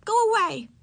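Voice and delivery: high, loud fierce command. A narrator's reading. Hidden girl to the strange peering boy, fiercely. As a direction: drive them off fiercely. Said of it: "she said fiercely".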